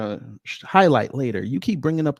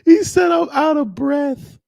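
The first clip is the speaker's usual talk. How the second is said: very high, squealing through laughter; laughing hard; 6 dB louder, slower than usual. Real, not acted. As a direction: barely get it out for laughing. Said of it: "I was laughing so hard".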